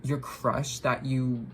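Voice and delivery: normal talking voice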